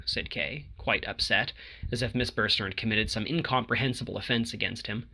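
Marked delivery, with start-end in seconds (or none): none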